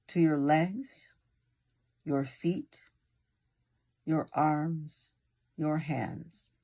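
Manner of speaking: unhurried meditation guide with long pauses; guided meditation. Acted. Meditation leader easing a listener into breathing and relaxation, guided meditation voice. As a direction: guide them gently, phrase by phrase, leaving room to breathe.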